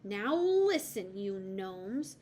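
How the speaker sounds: slow, loud stern start; firm scolding. A narrator's reading. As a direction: call them to order. Hazel scolds the gnomes.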